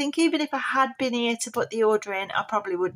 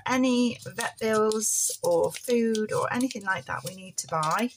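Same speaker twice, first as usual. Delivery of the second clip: usual speaking voice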